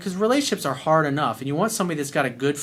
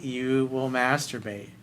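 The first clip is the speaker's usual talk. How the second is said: usual speaking voice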